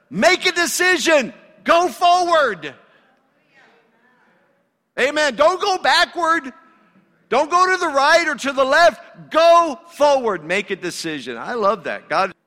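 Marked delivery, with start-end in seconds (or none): 0.1-1.3 high and loud, pushing hard on every word of "Make a decision"; impatient command. Retold or imitated. God's command, loud take. 1.6-10.4 full-force preaching. Real, not acted.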